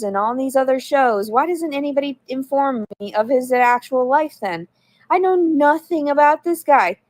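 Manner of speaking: exasperated indignant complaint; heated rant. Real, not acted. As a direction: vent your outrage at the chat. Ranting at the audience.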